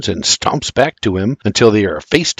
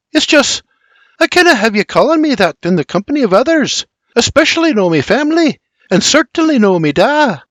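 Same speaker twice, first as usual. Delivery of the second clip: high, hurried Scottish explanation; anxious Scottish plea; 11 semitones higher, faster than usual. A narrator's reading. Mary warns William about her family, high hurried Scots. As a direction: rush out a worried Scottish request.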